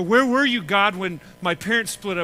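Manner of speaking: anguished cry. Retold or imitated. Congregation voiced demanding where God was.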